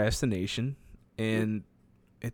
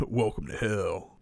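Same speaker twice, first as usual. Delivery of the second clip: mock-ominous echo; slower than usual. Co-host echoes the mock greeting.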